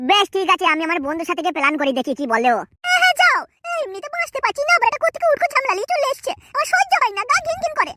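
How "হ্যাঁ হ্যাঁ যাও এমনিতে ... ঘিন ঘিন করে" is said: higher, complaining wife; angry wife. Acted. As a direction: nag the husband about his friend.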